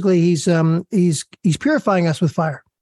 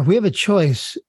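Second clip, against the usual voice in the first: ordinary talk, nothing marked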